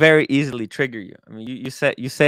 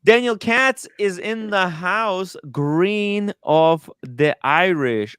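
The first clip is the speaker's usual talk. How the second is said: announcer intro; 4 semitones higher, slower than usual. Acted. Announcing a guest's arrival.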